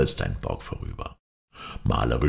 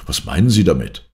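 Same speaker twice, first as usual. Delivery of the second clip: louder, mildly insistent question; exclaimed question; 5 dB louder than usual. A narrator's reading. Shandon challenges the doctor's claim, exclaiming a little louder. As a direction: call the question out, short of shouting. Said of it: "rief Shandon".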